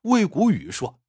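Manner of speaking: usual speaking voice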